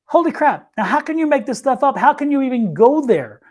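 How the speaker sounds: high-pitched retold astonishment; amazed. Reader recalling her reaction to the crash story, amazed. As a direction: marvel at it, pitch lifted.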